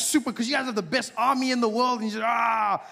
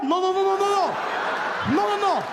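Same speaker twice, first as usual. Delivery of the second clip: high panicked denial; 10 semitones higher than usual. Pretend. Iran denies to America, high panicked shouting. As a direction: panic and deny everything.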